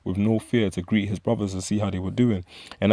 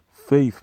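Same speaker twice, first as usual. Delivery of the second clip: Faith punched out higher and louder, falling; emphatic single word; 5 semitones higher, 6 dB louder than usual. Real, not acted. Preacher stressing Faith to his listeners, loud and falling. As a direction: land the word Faith hard like a sermon point. Preacher hammering home the lesson.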